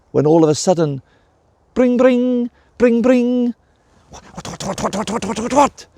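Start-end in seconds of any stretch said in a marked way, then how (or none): 1.7-3.5 high chirpy phone ringing. A narrator's reading. Narrator mimicking the mobile waking the dragon, high and bright. 4.1-5.9 sputtering, rising higher and higher; jolted awake, a flustered splutter; startled waking. A narrator's reading. Dragon woken by his phone, flustered splutter rising. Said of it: "said Rydal".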